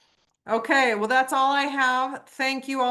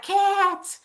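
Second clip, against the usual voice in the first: high, loud call across the stands; yelling for kids; 11 semitones higher, 6 dB louder, slower than usual. Retold or imitated. Mom yelling to her kids in the rodeo stands, loud high call. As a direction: shout for the kids to come down. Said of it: "And I'm, like, yelling".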